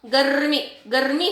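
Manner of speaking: गर्मी as "garr-mee"; correct rolled r. Shown as a demonstration. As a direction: trill the r in गर्मी straight into "mee". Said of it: "जो आपका जो जीभ है".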